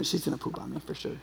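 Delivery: ordinary talk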